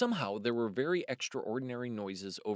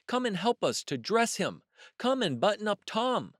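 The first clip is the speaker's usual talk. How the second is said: high, louder little kitten voice; kitten calling; 9 semitones higher, 4 dB louder than usual. Acted. Moppet calls the drake to help, high and eager. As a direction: call out eagerly like a small kitten.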